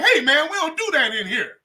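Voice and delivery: a touch of mock reproach; bongo player objecting. Comic imitating the bongo player telling him off, mildly high. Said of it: "the bongos was like".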